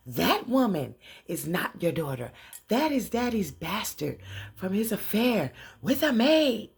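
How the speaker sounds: loud, high, emphatic denunciation; acted accusation. Leah exposes Angela to her mother, loud emphatic accusation. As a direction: point the finger with every stressed word. Leah's pointed public accusation.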